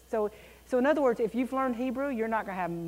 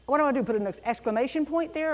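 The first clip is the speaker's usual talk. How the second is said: usual speaking voice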